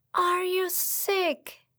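high, slow worried question. A narrator's reading. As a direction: ask gently, full of concern. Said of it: "fragt die Prinzessin besorgt".

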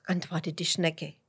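plain reading voice

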